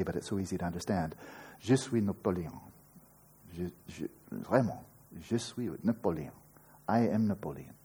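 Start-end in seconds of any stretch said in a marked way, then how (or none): 1.6-7.7 a lightly put-on French accent, playfully silly; mock French accent, as a deluded Napoleon. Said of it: "I'm gonna do this again 'cause it's silly".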